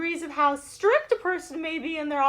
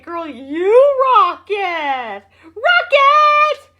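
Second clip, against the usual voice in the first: hyped cheer; 3 semitones higher, 8 dB louder, much slower than usual. Real, not acted. Host cheering Judy's comment, building to a loud shout.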